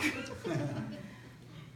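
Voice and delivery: a short airy laugh; breathy laugh. Real, not acted. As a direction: let out a quick breathy laugh. Laugh after rubbing rock.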